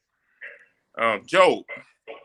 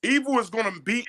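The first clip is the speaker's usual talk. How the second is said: preaching fervor; 10 semitones higher than usual. Real, not acted. Continues preaching.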